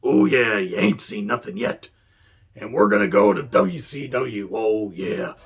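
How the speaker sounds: gravel-voiced Macho Man promo, imitating; Randy Savage impression. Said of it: "Doing a Vince and Savage impression".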